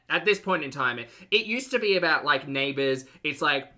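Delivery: ordinary talk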